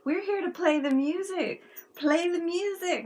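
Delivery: retelling her impatient insistence; a touch of exasperated urging; mock insistent. Insisting the band just play.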